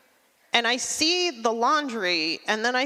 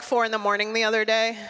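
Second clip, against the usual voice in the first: ordinary delivery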